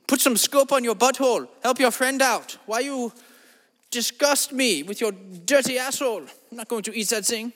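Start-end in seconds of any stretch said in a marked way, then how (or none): none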